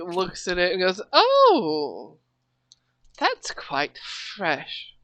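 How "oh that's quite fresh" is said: mock posh queen, slow and soft; a hint of a regal accent; wrong accent. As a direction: drawl it out lightly as a grand lady. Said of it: "That's the wrong accent".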